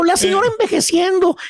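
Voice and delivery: ordinary talk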